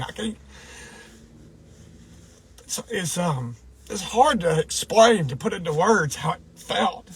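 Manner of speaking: fighting the lump in the throat; real tears near the surface; choked up. Real, not acted. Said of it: "I'm sounding like a crying baby".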